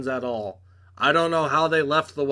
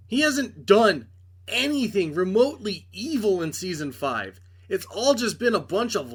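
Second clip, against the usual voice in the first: exasperated rant; 6 semitones higher than usual. Real, not acted. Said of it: "ranting about the villain".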